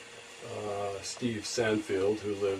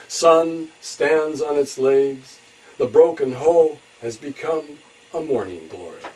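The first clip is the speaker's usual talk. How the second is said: higher, louder, measured phrases with falling ends; poetry reading; 8 semitones higher, 11 dB louder than usual. Acted. Poet reciting a tribute to an old friend, measured poetry reading. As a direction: declaim each line, pausing between images.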